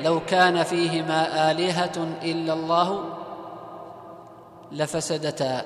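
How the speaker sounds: slow, drawn-out chanted recitation; Quranic recitation. Shown as a demonstration. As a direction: recite the verse slowly with tajweed. Teacher recites Quranic verse slowly. (تلاوة قرآنية بطيئة)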